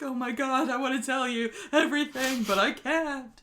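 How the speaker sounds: a hint of a man desperate to tell all; panicky Stephen. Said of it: "And he's like".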